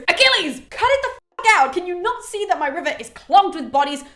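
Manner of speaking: loud, high exasperated telling-off; hammed-up yell, easing into indignant complaint; mock angry outburst. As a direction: scold Achilles as the fed-up river god. Acts out river god's angry outburst.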